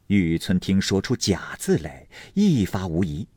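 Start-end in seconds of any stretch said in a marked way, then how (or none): none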